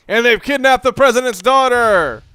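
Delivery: high, loud trailer-style exclamation; mock excitement. Gamer mocking the story's clichés, high loud mock hype. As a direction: oversell the cheesy plot twist. Mock hyping B movie plot.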